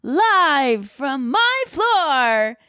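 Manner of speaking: hammed-up, drawn-out announcer shout; show announcer. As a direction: stretch each word out like a live show intro, climbing high. Mock live show opening announcement.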